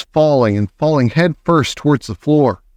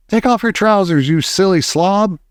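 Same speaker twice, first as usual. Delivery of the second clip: exasperated shout, pitched up; 6 semitones higher than usual. A narrator's reading. Another cook advising the frantic man, shouted. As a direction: call out the scolding order. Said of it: "someone else shouted".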